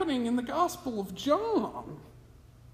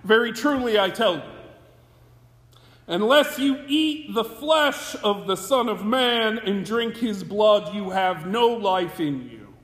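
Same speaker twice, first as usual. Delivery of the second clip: louder, measured reading of the scripture; solemn scripture reading; 2 semitones lower, 4 dB louder than usual. Acted. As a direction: proclaim the text to the congregation. Reads the Gospel passage aloud.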